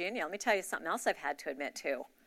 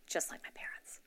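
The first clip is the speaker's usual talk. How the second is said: a dry aside under the breath; whispered aside; 9 dB quieter than usual. Real, not acted. Confessing to her church about her parents, whispered aside. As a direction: drop it in a hush to the audience.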